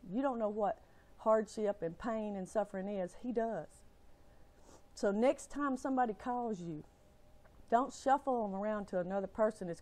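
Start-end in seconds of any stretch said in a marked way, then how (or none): none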